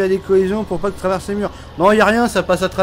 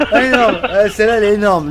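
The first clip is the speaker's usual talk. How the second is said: laughing; 6 semitones higher, 4 dB louder than usual. Real, not acted. Cracking up at a joke.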